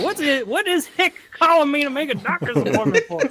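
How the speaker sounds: put-on high-pitched Southern accent through laughter; baffled Southern caller, hammed up. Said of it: "with the Southern accent".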